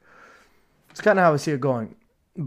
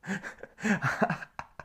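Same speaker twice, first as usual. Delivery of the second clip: short laugh; 7 semitones higher, 6 dB quieter than usual. Real, not acted. Laughs at his own slip.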